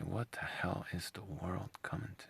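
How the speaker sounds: unvoiced whisper of disbelief; whispered what the hell aside. Real, not acted. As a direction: breathe it out in quiet disbelief. Whispered aside repeating question.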